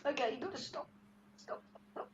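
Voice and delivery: very soft, fading to a near whisper; barely voiced, trailing into breath; spent plea. Real, not acted. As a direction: quietly beg it to stop after sobbing. Tells spirit to stop, after sobbing.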